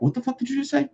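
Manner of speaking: high, confused outsider voice; mock bewildered out-of-towner; baffled newcomer. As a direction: squeak out total confusion. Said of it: "they're like".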